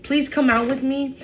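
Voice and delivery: mock pleading, climbing in pitch; begging. Someone begging to meet for coffee, pleading. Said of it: "They begged to be with you".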